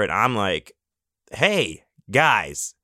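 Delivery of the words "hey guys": mock rude demand. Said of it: "No, I didn't say it like that".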